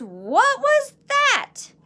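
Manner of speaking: very high, loud and slow amazed question; startled question. Acted. Kitten asking about what he saw in the mirror, high slow amazed cry. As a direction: stretch it out in wide-eyed wonder.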